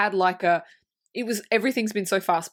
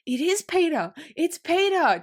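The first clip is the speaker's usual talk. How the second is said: higher, wonder-struck retold cry; delighted recognition; 7 semitones higher than usual. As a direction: act out a boy's face lighting up as he recognizes someone. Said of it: "his face lights up".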